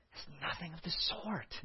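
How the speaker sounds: quiet correction. Shown as a demonstration. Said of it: "my tone of voice is intentional".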